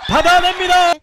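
high, very loud play-by-play cry; excited shout. Real, not acted. Commentator reacting to a saved ball, high excited shout. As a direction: yell it as the return lands. Live call of a great return.

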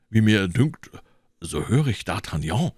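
ordinary delivery

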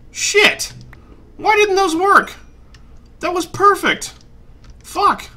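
high-pitched exasperated outburst; cursing in high-pitched disbelief; real frustration. Real, not acted. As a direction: voice shoots up as the plan fails. Ritual fails, player swears in frustration.

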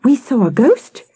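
high, urgent telling; insistent child. A narrator's reading. Victoria telling her mother, high and insistent.

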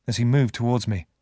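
plain reading voice

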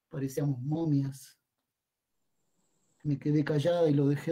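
soft, measured poetic monologue; hushed verse storytelling to a son; subdued poem reading. Acted. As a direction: let the recitation settle into quiet remembering, with pauses. Continues the dramatic monologue poem.

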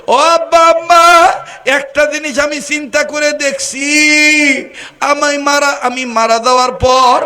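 high, loud wailing call; wailing cry. Acted. The dying speaker wails his last wish to parents.

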